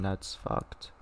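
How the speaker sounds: a hushed whisper under the breath. Real, not acted. Reacting to the video, whispered under the breath. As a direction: breathe it out, barely voiced.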